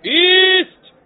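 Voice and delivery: very high, very loud bellow of halt; soldier's roar. A narrator's reading. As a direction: roar a command from behind. (نعرهٔ بسیار بلند سرباز)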